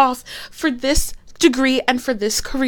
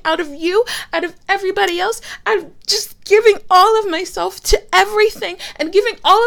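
higher and louder, building to a cry of tiredness; furious exhausted shout; 5 semitones higher, 3 dB louder than usual. Acted. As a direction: unload everything at once. Shouting match peak.

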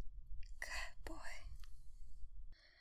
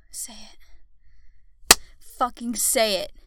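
louder, insistent order; forceful command; 11 dB louder, much slower than usual. Acted. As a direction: repeat the order with sudden force.

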